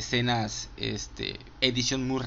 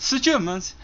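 The Germans in a high, louder English accent; 10 semitones higher, 5 dB louder than usual. Shown as a demonstration. Fan showing off his practiced English accent on a Snatch line. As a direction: nail "The Germans" like a Brit. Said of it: "trato de decirlas con el acento inglés".

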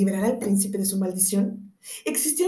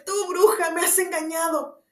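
howling; 9 semitones higher than usual. A narrator's reading. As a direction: roar the accusation. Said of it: "aulló la bestia".

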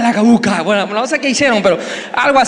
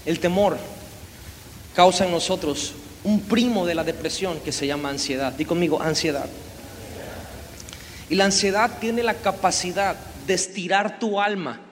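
soft intimate preaching; 5 semitones lower, 8 dB quieter than usual. Acted. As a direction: speak gently to each listener's heart. Soft reflective preaching section.

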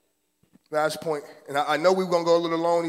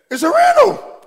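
mock high squeal; 10 dB louder than usual. Mock announcing a rental.

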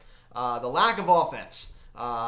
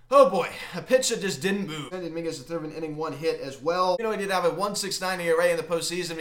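ordinary talk, nothing marked

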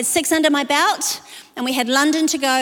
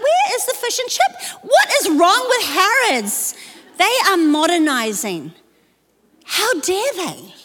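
mock outrage; 6 semitones higher than usual. Mock outrage at missing fish bar.

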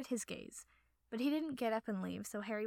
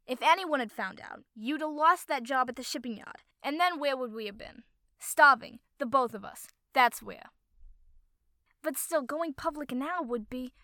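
loud, higher angry rant; furious argument; 3 semitones higher, 10 dB louder than usual. A narrator's reading. Partner scolding about the lost shipping yard job, furious loud rant. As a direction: hammer your point home in anger. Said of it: "the other replied furiously".

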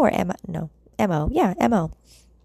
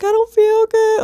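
high, loud, drawn-out mock cry; whiny crying baby; 5 dB louder than usual. Crying to mom over the phone, babyish mock whine. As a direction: imitate yourself whining like a little girl. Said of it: "acting like a little ass girl, like a baby".

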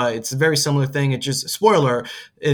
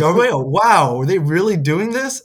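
louder, higher excited disbelief; amazed viewer; 4 semitones higher, 5 dB louder than usual. Retold or imitated. Viewer amazed by the twist, excited disbelief. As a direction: gasp it out amazed. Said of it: "I was like".

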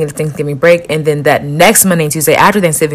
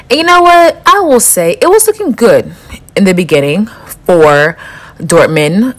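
high, louder excited build-up; 4 semitones higher, 3 dB louder than usual. Real, not acted. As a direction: lift into an eager reveal. Animated about Dortmund match.

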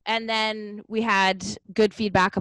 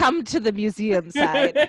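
playful laughing; 3 semitones higher, 4 dB louder, slower than usual. Real, not acted. Teasing invitation to her side.

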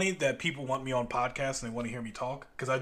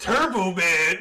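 Turbo Man shouted louder and higher; mock shout; 5 semitones higher, 8 dB louder, much slower than usual. Cohost shouts the movie dad's plea in jest. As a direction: yell it like a desperate movie dad.

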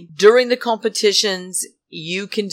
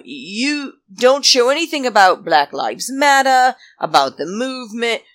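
indignant rant; 6 semitones higher than usual. Real, not acted. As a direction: call them out hard. Angry rant at the production.

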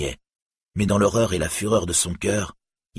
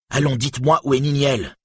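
high, demanding cry; impatient, raised-pitch exclamation; urgent cry; 7 semitones higher, 3 dB louder than usual. A narrator's reading. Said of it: "s'écria-t-il".

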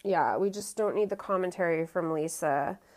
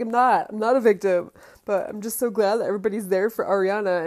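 louder, mocking self-pitying plea; mock Tom apology; 2 semitones higher, 7 dB louder, faster than usual. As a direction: ham up his fake sincerity a little. Tom's self-pitying reunion reply.

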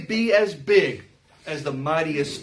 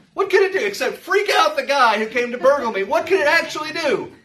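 louder, higher rhetorical demand; emphatic challenge; 7 semitones higher, 4 dB louder than usual. Acted. Preacher challenging the congregation, louder and higher. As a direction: press them for an answer.

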